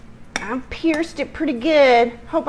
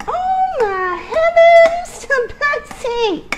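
much higher, slow, drawn-out gasp; delighted exclaim; 14 semitones higher, slower than usual. Real, not acted. Delight soaking the cake.

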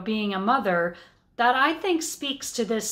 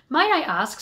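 a clerk's curious question, noticeably higher; new interest; 6 semitones higher, 3 dB louder than usual. A narrator's reading. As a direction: perk up with sudden interest. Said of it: "said the clerk with new interest".

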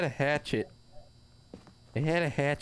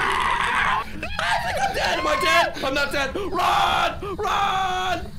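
high, loud, panicked shrieks while fleeing; screaming and laughing as the ghost gives chase; 7 dB louder, much slower than usual. Real, not acted. Player screaming to friends while fleeing the ghost, panicked shrieks.

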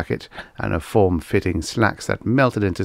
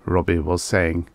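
plain reading voice

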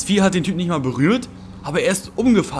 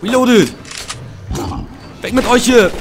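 yelling at monsters; 6 semitones higher, slower than usual. Real, not acted. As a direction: bark them away as they swarm in. Shooting creatures in the game.

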